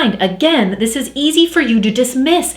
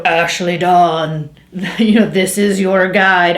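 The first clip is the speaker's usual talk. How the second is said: slow, low mock-booming man's voice; big, drawn-out booming male announcement; 3 semitones lower, slower than usual. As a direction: boom it out slowly, like a spirit guide. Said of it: "a booming male voice".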